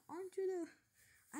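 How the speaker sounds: sudden high, slow gasp of recognition; awestruck recognition. Acted. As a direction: stretch it out in wide-eyed disbelief. Naruto recognises the Nine Tails.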